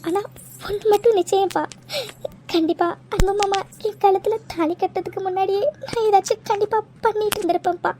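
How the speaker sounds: normal talking voice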